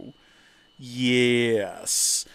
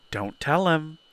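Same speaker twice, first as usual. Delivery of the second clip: normal talking voice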